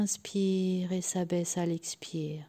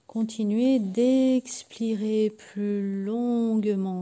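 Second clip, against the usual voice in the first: slow, stretched vowels like a long exhale; drawn out; 3 semitones higher, slower than usual. Shown as a demonstration. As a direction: draw the words out to lengthen the breath. Models the longer out-breath. (mots étirés, très lentement)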